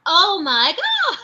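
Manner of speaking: slow, drawn-out amazed exclamation; imitating an astonished mother seeing a video call; amazed mama.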